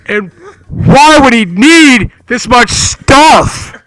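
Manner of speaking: loud, slow, hammed-up dramatic read. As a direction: boom it out like a movie trailer. Rereads the line in dramatic voice.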